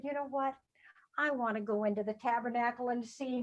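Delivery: soft, hushed wish; hushed Hannah. Acted. Hannah quietly telling herself she wants in, hushed. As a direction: Hannah hushing her hopeful plan.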